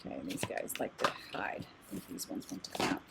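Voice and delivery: very soft, low, whispery aside; muttering to herself. Real, not acted. Card reader to herself while drawing cards, muttering under her breath. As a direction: talk under your breath, barely voiced.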